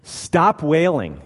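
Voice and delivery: loud, slow, forceful order; stern command. Shown as a demonstration. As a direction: order the mourners to stop, firmly. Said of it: "He is saying".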